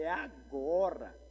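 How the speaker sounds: drawn-out, relishing "now's my moment"; savoring a big opportunity, measured volume; eager resolve. Retold or imitated. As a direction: stretch it out, seizing the chance. Retold eager reaction on spotting idol.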